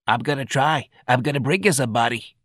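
feeble, sickly voice; weak promise. Acted. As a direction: promise weakly. Sick patrolman promises.

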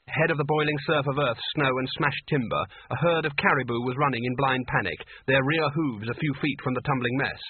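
usual speaking voice

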